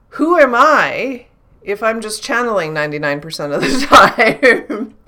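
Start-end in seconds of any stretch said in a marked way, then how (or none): 3.6-5.0 laughing. Real, not acted. Laughing at own idea.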